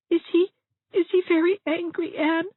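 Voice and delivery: very high, timid tearful question; tearful Dovey, anxious. A narrator's reading. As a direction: ask fearfully in a high whimper.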